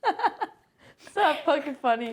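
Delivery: slow, high, through laughter; laughing. Real, not acted. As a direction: protest while cracking up. Protesting while laughing.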